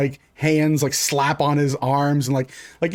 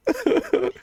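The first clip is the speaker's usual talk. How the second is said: very high, wordless whimpers; acted whimpering. Suspect being searched with a leather glove, high whimpering. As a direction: squeak out mock whimpers.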